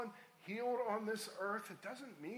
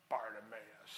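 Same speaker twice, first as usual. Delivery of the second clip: low, drawn-in relish; savored name; 10 semitones lower than usual. Shown as a demonstration. Preacher relishing a strong name for the congregation, savored low.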